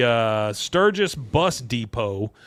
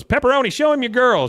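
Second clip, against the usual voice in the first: raised, loud call to his woman; imitated biker's command; 11 semitones higher, 6 dB louder than usual. Acts biker's order to woman.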